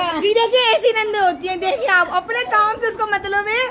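mock grievance. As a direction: complain to the camera in pretend indignation. Complaining to viewers about in-laws.

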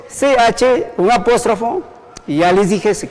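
ordinary talk

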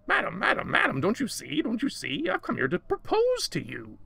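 high, excited creature voice; eager little creature. A narrator's reading. Creature proposes to madam, high and eager. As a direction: plead it in high, urgent repeats.

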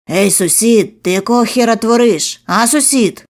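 loud, rowdy neighbour yelling through the door; angry neighbour. Acted. Narrator shouting at the door as the angry neighbour. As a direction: bang and shout like a furious neighbour. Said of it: "Роль злого сусіда".

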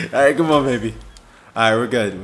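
normal talking voice

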